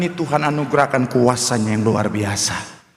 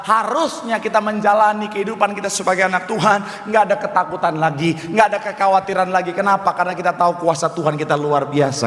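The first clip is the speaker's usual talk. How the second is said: fervent, raised-pitch preaching; 4 semitones higher than usual. Real, not acted. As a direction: drive it home to the congregation. Preaches at full force. (khotbah berapi-api dengan nada tinggi)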